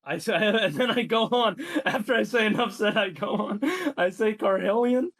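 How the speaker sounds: can't keep a straight face reading it out; amused, laughing over his own written line; laughing while talking. Real, not acted.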